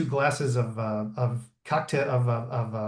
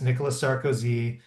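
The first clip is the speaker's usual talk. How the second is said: ordinary talk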